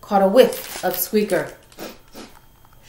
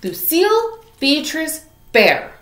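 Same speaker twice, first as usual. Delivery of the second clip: higher, louder full-name scold; scolding mom; 7 semitones higher, 3 dB louder than usual. Acted. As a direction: call her out by full name, sternly.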